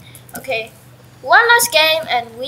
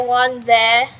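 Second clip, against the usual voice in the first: normal talking voice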